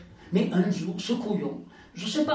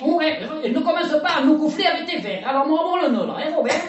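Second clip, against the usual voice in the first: loud scolding; 6 semitones higher, 6 dB louder than usual. Acted. Lucette snapping at Robert.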